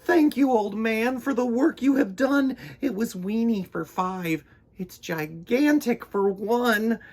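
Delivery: put-on high, happy old woman; grateful old lady. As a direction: thank him cheerfully. Old lady thanks old man.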